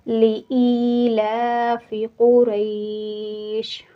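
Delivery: slow melodic Quranic recitation, stopping on कुरैश with sukun; tajweed recitation. Shown as a demonstration. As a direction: chant लिईलाफि कुरैश slowly, ending कुरैश without the final vowel. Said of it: "कुरैश हम पढ़ेंगे यहाँ पर". (धीमी तजवीद वाली तिलावत, कुरैश पर सुकून के साथ)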